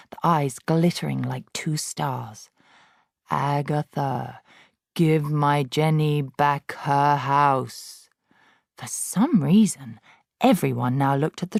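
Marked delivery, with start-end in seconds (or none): 3.3-8.1 ghostly voice. Acted. Ghost of Magnus commanding Agatha, slow. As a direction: command it slowly from beyond.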